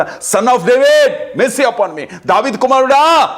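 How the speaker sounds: preacher voicing Bartimaeus calling to Jesus, a high drawn-out plea; blind man's cry. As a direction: cry out for mercy as the blind beggar would. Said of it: "గుడ్డివాడైన భర్తమయ్య ఏమర్చాడట".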